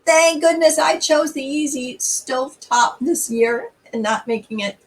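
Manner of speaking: ordinary talk, nothing marked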